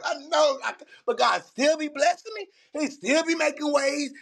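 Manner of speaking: real tears pushing through grateful words; crying while praising. Real, not acted. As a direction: voice breaking with emotion. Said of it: "laughing and crying at the same time".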